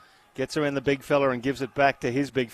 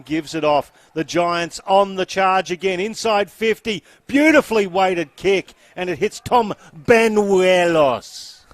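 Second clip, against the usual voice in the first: louder, higher call as the attack builds; excited play-by-play; 5 semitones higher, 7 dB louder than usual. Real, not acted. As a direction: build to a big, drawn-out name at the end. Live play-by-play.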